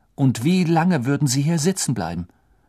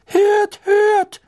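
very high, loud squeak; squeaky; 7 dB louder than usual. A narrator's reading. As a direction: squeak it like a tiny professor. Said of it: "quiekte der kleine Professor Flitwick".

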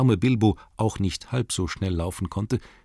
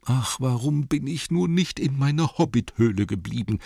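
high, put-on whine of a poor hobbit; wailing hobbit lament; 6 semitones higher than usual. A narrator's reading. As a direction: lament in a raised, self-pitying voice. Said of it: "sagte der arme Herr Beutlin".

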